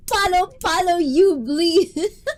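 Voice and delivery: high, loud, laughing playful threat; giggling pretend menace; laughing mock threat. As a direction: tease back through laughter.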